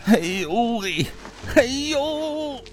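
high moaning ouch; pained groaning. A narrator's reading. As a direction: whimper from the floor. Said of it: "趴在地上直哼".

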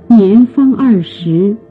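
usual speaking voice

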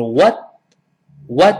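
"what" with the final t held, not released; unreleased final t. Shown as a demonstration. Teacher models "what" for learners, t unreleased. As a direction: stop the t of "what" at the tongue tip. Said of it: "失去爆破的what".

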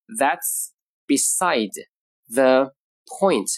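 word by word with clear pauses; very slow, drawn-out teaching delivery; slow model phrase. Shown as a demonstration. Teacher introducing today's expression, slow and separated.

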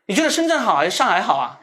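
ordinary talk, nothing marked

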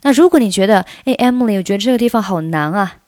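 ordinary talk, nothing marked

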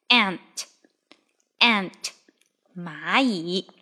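ordinary talk, nothing marked

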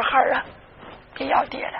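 put-on hushed, grieving plea to a child; tearful mother. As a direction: speak softly as the weeping mother. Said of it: "当时就哭了". (母亲含泪轻声劝)

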